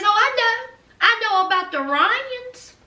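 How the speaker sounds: high-pitched, knowing mock taunt. Elena cornering Mia with her secret, taunting.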